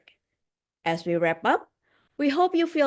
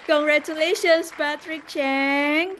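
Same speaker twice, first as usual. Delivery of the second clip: hyped giveaway announcement; 5 semitones higher, 3 dB louder than usual. Real, not acted. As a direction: cheer the winner's name like a game show. Announcing lucky draw winner.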